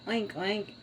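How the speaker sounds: soft mock pig oinks. Shown as a demonstration. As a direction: oink like a little pig. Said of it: "And pig".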